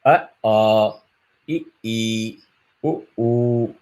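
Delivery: long aa, ii, uu, each two beats; long vowels. Shown as a demonstration. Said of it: "which is dirgham, is two matras".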